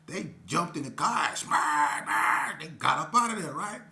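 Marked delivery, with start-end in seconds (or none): 1.3-2.6 punchy, louder mock crash noise; imitated crash. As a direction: bark out the smash like a car impact. Car smashing through to escape.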